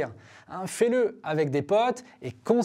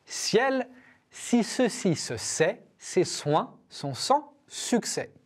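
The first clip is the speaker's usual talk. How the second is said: slow, every syllable articulated; slow careful diction; 3 semitones higher, much slower than usual. Shown as a demonstration. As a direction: spell it out slowly, clearly. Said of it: "on va reprendre ensemble".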